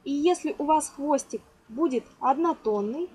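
ordinary talk

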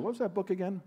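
high, lightly voiced woman asking again; a touch of a sheepish woman on the phone; the guest calls back, lightly voiced; sheepish question. Woman calls a year later.

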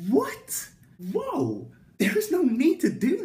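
stunned high what, whoa; shocked. Real, not acted. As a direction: react in total disbelief. Shocked reaction to her challenge.

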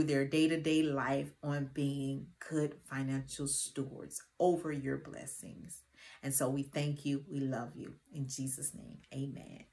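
soft, hushed prayer voice; murmured closing prayer. Acted. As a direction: pray quietly and reverently to God. Prays before the lesson.

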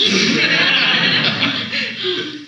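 a burst of high giggling laughter; amused laugh. Real, not acted. Host laughing at the Son of God claim, giggling.

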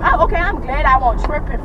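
ordinary delivery

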